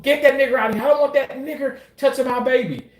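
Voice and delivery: high, mock yell of an angry patient; quoting the patient's high-pitched outburst. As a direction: throw the insult at him like the furious patient did. Patient's insult quoted again.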